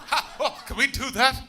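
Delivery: high, loud excited yelp at the crowd; hammed-up delighted crowd, high and loud; mock audience excitement. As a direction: play the whole room getting giddy. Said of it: "As you all went".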